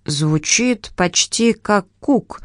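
slow, thoughtful drawl; slow musing. Acted. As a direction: weigh each word slowly. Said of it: "сказал мистер Поппер".

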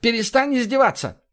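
drawn-out curse, then high protest; high-pitched, acted exasperation; exasperated outburst. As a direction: lash out at the friend mocking you. Exasperated outburst at being mocked.

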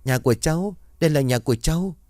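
put-on delirious child in a fever dream; feverish mumbling. A narrator's reading. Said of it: "nó lại nói miên man". (bé trai sốt mê sảng, giọng cao)

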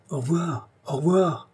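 light high call, falling at the end; farewell call. A narrator's reading. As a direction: call farewell in a lifted, contained voice. Friend calls farewell from the basket.

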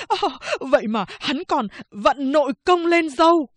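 high, fast, fretful complaining barber voice; whiny frightened barber. Acted. Barber complains to guards about the prisoner's beard, whiny and high. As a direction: moan about the ruined blade like a scared little barber.

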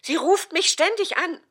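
fed-up mother lets loose; exasperated outburst. Acted. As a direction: vent the irritation full force. Mother ranting about caller.

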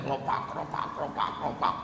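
voiceless, soft mock slapping noise; whispered slap sound. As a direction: mimic the blows under your breath.

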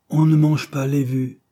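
loud, high pronouncement; sententious. A narrator's reading. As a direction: lay it down like a stern maxim. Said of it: "d'un ton sentencieux".

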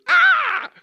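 short, very loud high scream; startled shriek. Retold or imitated. Nurse seeing mom walk, startled shriek. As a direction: shriek in sudden shock.

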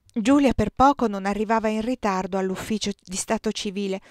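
usual speaking voice

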